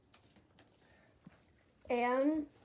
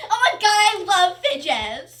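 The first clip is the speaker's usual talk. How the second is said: loud, high fake enthusiasm; fake YouTuber excitement; 6 semitones higher, 16 dB louder than usual. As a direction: switch on the camera smile. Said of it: "Turns camera back on".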